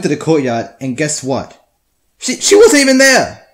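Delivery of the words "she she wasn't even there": very high, loud cry of disbelief; outraged yell. Real, not acted. Storyteller went to the courtyard for nothing, outraged yell. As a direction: shout the punchline in indignation.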